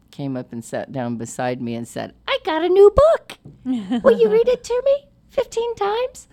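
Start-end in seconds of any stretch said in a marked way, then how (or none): 2.3-6.3 put-on very high, loud little-kid voice; excited small child squeaking out a request. Friend's five-year-old asking for a book reading, high loud child voice. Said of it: "five-year-old at Hanukkah, and she".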